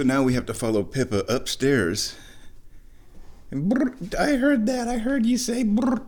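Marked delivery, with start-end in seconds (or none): 3.5-6.0 high cooing pet voice; cat talk. Real, not acted. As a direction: coo back at the cat. Answering the cat's brr.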